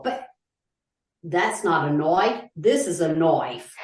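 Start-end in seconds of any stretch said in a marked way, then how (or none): 1.2-3.7 slow, hammed-up Australian catchphrase; put-on Australian accent. Podcaster quoting an Australian film line, mock Australian accent. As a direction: play the Aussie tough guy, drawn out.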